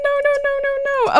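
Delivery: rapid high no-no-no; high pleading. Real, not acted. Player begging her Sims to stop, high pleading. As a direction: beg the game to stop, voice way up.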